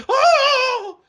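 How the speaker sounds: high, loud startled yelp with a laugh; startled mom. Retold or imitated. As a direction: jump back as if you just saw the bottle. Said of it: "she's like".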